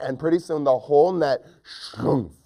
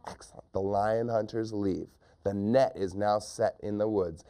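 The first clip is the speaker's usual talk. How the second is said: plain reading voice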